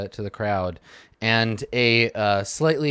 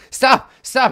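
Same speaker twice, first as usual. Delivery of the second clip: put-on pleading kid; 12 semitones higher, 6 dB louder than usual. Kid crying uncle in scuffle.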